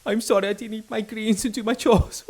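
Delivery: high, loud, hammed-up blubbering son; mock wailing child. Traumatized son apologising to mummy, mock wailing. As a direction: wail like a sorry little kid, badly overdone.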